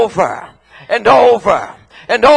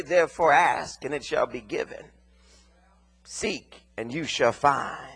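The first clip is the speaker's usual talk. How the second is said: softer, lower quoting of scripture; soft scripture quote; 10 semitones lower, 11 dB quieter than usual. Acted. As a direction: read the words he never said, hushed.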